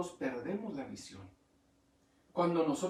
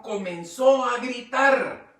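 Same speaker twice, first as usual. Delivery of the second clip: emphatic shout; 5 semitones higher, 10 dB louder, slower than usual. Acted. Predicador enfatiza el grito.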